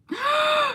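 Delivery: shocked gasp. Retold or imitated. Teacher sees bleeding child.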